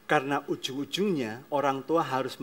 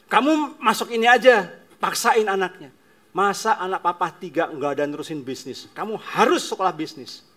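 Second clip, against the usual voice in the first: a hint of a domineering father, louder; pushy father; 5 semitones higher, 8 dB louder than usual. Father forcing son into business school, louder. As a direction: press the son like a stubborn father.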